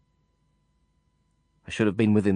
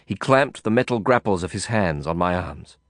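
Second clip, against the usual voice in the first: plain reading voice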